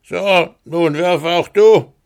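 laut und höher, höhnisch drohend; spottender Riese. Acted. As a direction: ein Riese, der den Knirps verlacht. Riese fordert Hans heraus. (höhnischer Riese, laut und höher)

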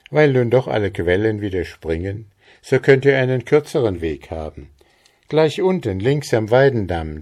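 höher und lauter, wohlwollend erklärend; gütige Fee, lebhaft; Trude, freundlich. Acted. Trude explains the way. (Trude erklärt Maren freundlich den Weg, höher und lauter)

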